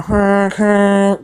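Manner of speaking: voiced train horn blasts; train whistle imitation. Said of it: "just with the whistle".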